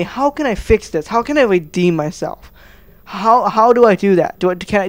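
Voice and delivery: ordinary talk, nothing marked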